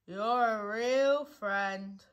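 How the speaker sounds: very slow, doleful thanks; gloomy. A narrator's reading. Eeyore thanking Pooh for helping, gloomily. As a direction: drag it out mournfully.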